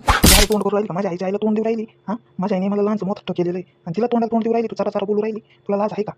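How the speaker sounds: loud, furious telling-off; angry scolding. Acted. Husband angrily scolds wife for answering his mother, loud. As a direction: snap at her for talking back to your mother.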